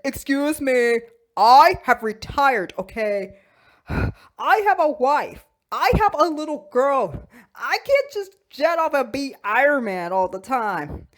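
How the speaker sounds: loud, emphatic mock indignation; indignant refusal. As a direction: protest firmly, leaning on key words. Iron Man indignantly refuses.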